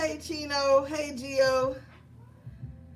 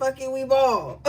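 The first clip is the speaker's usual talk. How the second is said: spirit's message; 2 semitones higher, 6 dB louder than usual. Retold or imitated. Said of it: "Spirit said".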